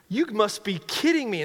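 outraged protest; defiant outburst. Retold or imitated. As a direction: push back hard. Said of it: "puts up this whole fight".

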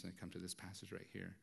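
ordinary delivery

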